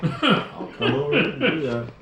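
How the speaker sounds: slow offer through real laughter; laughing offer. Real, not acted. Mechanic offers to kick-start it, laughing.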